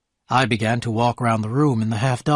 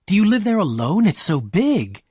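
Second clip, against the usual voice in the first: excited; 6 semitones higher than usual. A narrator's reading. Daisy to Gatsby, seeing his house, excited. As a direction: gasp it out in delight at the mansion. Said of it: "she cried excitedly".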